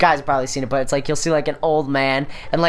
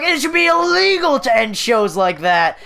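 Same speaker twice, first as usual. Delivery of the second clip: imitating mad dad; 9 semitones higher, 4 dB louder than usual.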